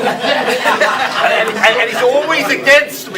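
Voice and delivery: loud, high, laughing through the words. Real, not acted. Laughing complaint about rival.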